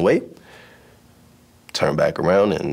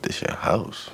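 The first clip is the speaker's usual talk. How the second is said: incredulous whisper; 3 semitones higher, 6 dB quieter, slower than usual. Retold or imitated. Incredulous retort to bully.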